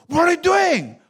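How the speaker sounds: high-pitched mock outrage; outraged protest. Preacher acts an American just stabbed, outraged protest. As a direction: play-act a shocked, angry victim. Replays reacting to being stabbed.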